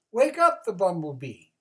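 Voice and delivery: loud squirrel order; squirrel shouting. Acted. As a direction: call it out loud. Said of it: "said the squirrel".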